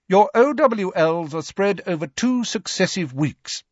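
high, strict schoolmistress voice; McGonagall. Acted. Professor briefs class on exam rules, sternly. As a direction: lay down exam rules formally, pitched high.

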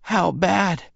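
high, faint frightened woman; breathy high female voice; weak Bella voice. A narrator's reading. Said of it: "She took a shallow, fluttery breath".

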